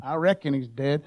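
mock Deep South reckon; lazy Southern American accent; a hint of a Southern drawl. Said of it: "It's kind of Southern".

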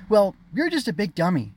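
put-on high, loud childish insult; sputtering retort. A narrator's reading. Sister at brother's back, angry sputter. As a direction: throw a small tantrum. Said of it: "She stamped and sputtered at his back".

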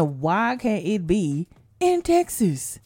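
slow, drawn-out lament; slow complaint. Acted. Host complaining about lottery winners, slow plaintive question. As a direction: stretch out the question in protest. First, slower take of complaint.

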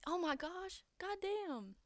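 high, startled exclamation. Real, not acted. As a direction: react with real surprise, pitch jumping up. Startled by loud can opening.

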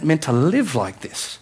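normal talking voice

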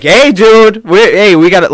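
mock call for attention. Friend acting out a warning to others, calling out.

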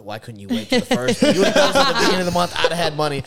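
high, loud, laughing mock complaint to the hacker; playing broke victim. Pretending to be broke to the scammer, laughing mock whine. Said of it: "acted like I was completely broke".

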